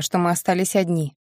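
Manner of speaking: ordinary delivery, nothing marked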